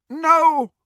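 high, loud cry of pain; anguished moan. Acted. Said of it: "he moaned".

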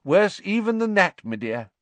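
high, loud, grave correction; emphatic official disclosure; emphatic minister. A narrator's reading. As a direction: stress that it is even worse. Fudge hinting at worse news.

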